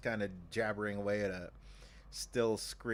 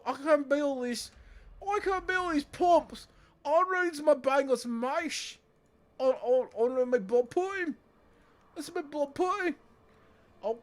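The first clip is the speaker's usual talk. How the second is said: much higher, louder hammed-up British accent; 9 dB louder than usual. British worker complaining about pumps, hammed-up British accent. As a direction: play a cartoon British worker moaning about blood pudding. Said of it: "Oh, British".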